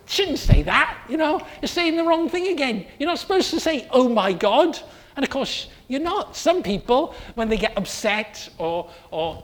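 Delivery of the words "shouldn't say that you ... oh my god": higher, mock-scolding voice; a hint of a fussy objector; gently mock tut-tutting; scolding listener. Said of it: "some of you might say".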